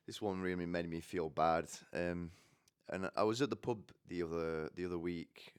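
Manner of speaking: ordinary talk, nothing marked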